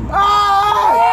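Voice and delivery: loud high yelp from the cold; shocked scream. Real, not acted. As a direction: scream as the ice water hits. Ice water dumped on him.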